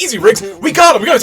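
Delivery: acted-out urgent yell; retold urgent shout. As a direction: call off a worked-up partner. Acting out partner restraining Riggs.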